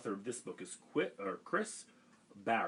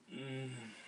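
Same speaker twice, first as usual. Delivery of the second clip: content hum; 4 semitones higher, 5 dB quieter than usual. A narrator's reading. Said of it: "said Camilla".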